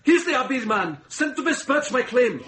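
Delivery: loud, high, fast defiant outburst; angry protest. Acted. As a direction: shout him down in outrage. Man resisting court, shouting.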